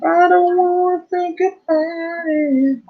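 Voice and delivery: very high, slow squeaky voice. Pretend. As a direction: squeak it out slowly. Squeaky put-on voice.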